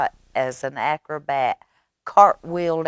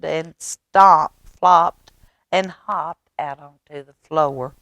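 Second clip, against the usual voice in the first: usual speaking voice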